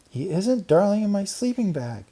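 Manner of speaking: put-on high indignant girl voice. A narrator's reading. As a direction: object crossly. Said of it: "said Karen".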